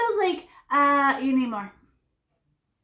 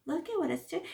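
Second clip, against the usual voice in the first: mock wonder; 4 semitones higher, 8 dB quieter than usual. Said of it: "you're like".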